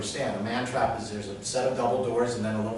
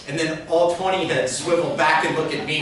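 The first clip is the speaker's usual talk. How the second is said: ordinary talk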